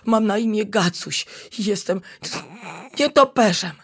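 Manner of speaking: usual speaking voice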